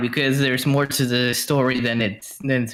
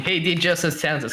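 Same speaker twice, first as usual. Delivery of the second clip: ordinary delivery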